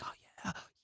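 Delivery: whispered, barely voiced reply; hushed imitation. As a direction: imitate their answer in a tiny whisper. Girls' reply to edible offer acted.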